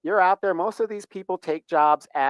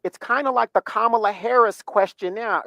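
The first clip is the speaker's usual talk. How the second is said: normal talking voice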